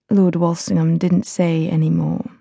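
ordinary delivery, nothing marked

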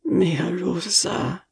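slow, gasping, pained; a dying man's choked whisper of her name; tangled gasp. A narrator's reading. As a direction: force the name out through pain. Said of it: "in a tangled gasp".

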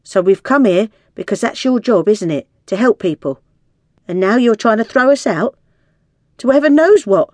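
indignant protest. A narrator's reading. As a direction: build to an outraged high finish. Woman protests being thrown out.

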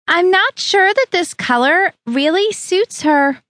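put-on high, slow, sighing disapproval from a fussy older woman; a fussy older woman sighs at the dress color, high and slow; sighing Harriet. A narrator's reading. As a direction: sigh it out like a prim mother-in-law finding fault. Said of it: "Harriet Prophet sighed".

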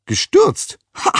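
outraged disbelief, then a sharp ha; cleaning woman scoffing at the professor; incredulous scoff. A narrator's reading.

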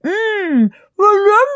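high, muffled words through food; mouth full. A narrator's reading. Billy offers berries while chewing, mouth full. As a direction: try talking with crammed cheeks. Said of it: "cramming them into his mouth".

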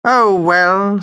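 high, drawn-out sighing complaint; sighed resignation. A narrator's reading. Put-upon vampire answers Judy with a martyred sigh. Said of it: "sighed the vampire".

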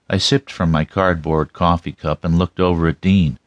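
ordinary delivery, nothing marked

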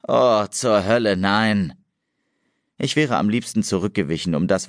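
plain reading voice

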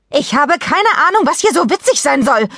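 high-pitched, indignant shout; mother bellowing in exasperation; angry yell. A narrator's reading. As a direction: yell furiously at someone behind the door. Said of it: "brüllte Mom die Tür an".

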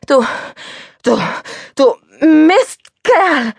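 high, loud slow furious yell; stammered then shouted insult. A narrator's reading. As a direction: explode at the driver. Nearly hit by reckless car.

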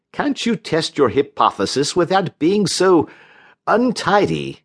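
high-pitched, prissy scolding; fussy reproach. A narrator's reading. Division chief fussily scolds Barry.

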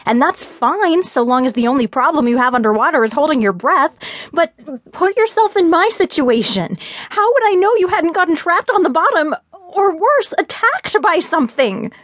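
high-pitched, agitated argument; deepening rage. A narrator's reading. Fiona makes Gabe see her situation, deepening rage. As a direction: argue it heatedly, pitch climbing high. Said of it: "as my rage deepened".

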